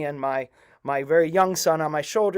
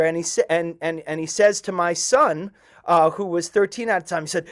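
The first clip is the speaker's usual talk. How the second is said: ordinary delivery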